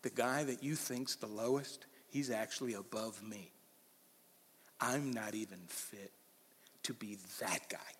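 fading to a whisper; hushed humble confession. Retold or imitated. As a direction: let it drop to a hush. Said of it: "John the Baptist is saying".

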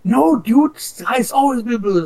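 high, loud imitation of someone arguing; retold outburst of disbelief, pitched right up; mock exasperated protest. Baited stranger argues with a troll about the sky, exasperated.

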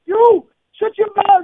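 high, loud, slow shout; a politician yelling down a heckler; furious comeback. Retold or imitated. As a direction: bark it back at the heckler. Politician fires back at heckler.

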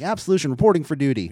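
quick, keen reporting in; eager reporting. Retold or imitated. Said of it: "They were like".

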